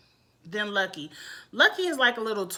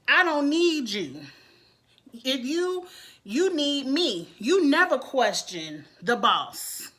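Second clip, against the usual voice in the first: acted-out imperious put-down, drawn out; Jocelyn; 3 semitones higher, slower than usual. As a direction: lay down the law slowly, as the boss, in imitation. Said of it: "let's go into Jocelyn's rant".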